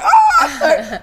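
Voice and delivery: high, loud mock scream; comic panicked yell through laughter; hammed-up freak-out scream. Replaying the on-camera meltdown, mock panicked scream. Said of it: "I was freaking the fuck".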